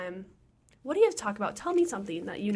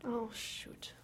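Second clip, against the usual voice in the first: whispering; 15 dB quieter than usual. Real, not acted. Caught swearing, hushed.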